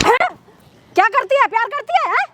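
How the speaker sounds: outraged mother's tirade; scornful, indignant scolding; angry scolding. Acted. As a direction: berate him with indignant questions. Acted mother confronts daughter.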